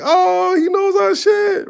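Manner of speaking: the crowd cheering that he gets them; excited crowd shout. Retold or imitated. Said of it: "it's like".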